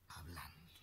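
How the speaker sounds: hushed, unvoiced whisper; whispered for effect. Real, not acted. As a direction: drop to a breath of a whisper. Sermon drop to whisper.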